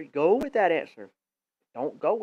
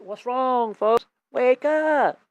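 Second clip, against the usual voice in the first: calling out; 6 semitones higher, 8 dB louder, much slower than usual. Real, not acted. Preacher calling out his audience.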